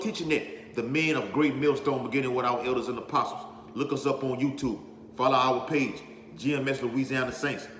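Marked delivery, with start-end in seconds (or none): none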